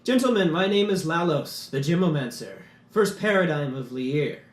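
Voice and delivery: stately gem mage introducing his council; grand gem mage. Acted. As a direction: announce yourselves like a proud magister. GM voices Lalos the Gemomancer.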